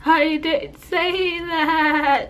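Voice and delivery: slow, high, trembling denial; shaky nervous denial. Retold or imitated. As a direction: drag it out like you're shaking with nerves. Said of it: "And you can hear it in my voice".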